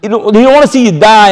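high, fervent preaching cry; rising preacher's fervor. Acted. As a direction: lift it up like a pulpit appeal. Preaching passionately about the Torah.

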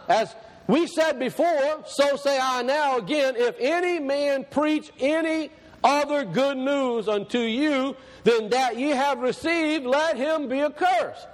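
stretched-out, proclaiming Bible verse; scripture reading. Acted. Reads scripture aloud to congregation.

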